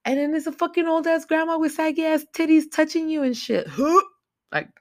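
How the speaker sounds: high, loud squeal of disgust; grossed-out outburst. Real, not acted. Reviewer recoiling at the naked grandma scene, grossed-out squeal.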